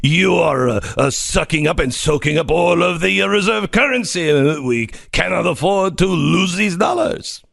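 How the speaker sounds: mock Macron French accent; lightly put-on French accent. Host asks him to do his French Macron accent. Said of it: "Can you do it in your Macron accent".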